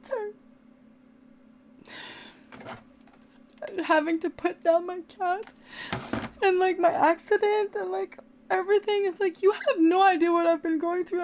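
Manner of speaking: high, tearful voice pushing through the upset; crying. Real, not acted. Cat owner venting about loss and attack, crying. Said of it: "I'm crying not because of".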